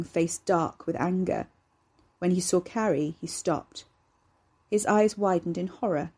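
usual speaking voice

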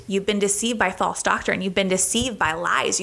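ordinary talk

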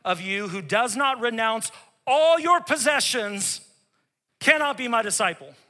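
fiery scripture reading; Jesus's words preached. Acted. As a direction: proclaim it with force, slowing on take up his cross. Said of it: "Jesus told his disciples".

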